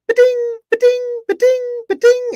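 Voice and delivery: high, loud "ba-ding" chimes; imitated watch chime. Imitating the smartwatch going off at the doctor's, high chimes. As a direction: mimic a smartwatch buzzing over and over. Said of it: "And it's going".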